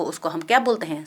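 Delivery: ordinary talk, nothing marked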